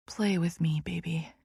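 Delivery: breathy whisper of a teasing lover; barely voiced, close to his ear. A narrator's reading.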